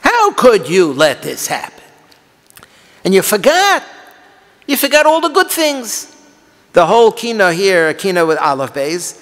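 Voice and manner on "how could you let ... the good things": pained challenge climbing much higher; accusing lament. Shown as a demonstration. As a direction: cry out the grievance, rising with each phrase. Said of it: "read it with the right tone of voice".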